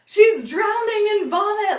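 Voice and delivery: high, loud, near-hysterical cry; distraught wail. Retold or imitated. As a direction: wail it like a drunk crying girl. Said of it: "I just start bawling my eyes out".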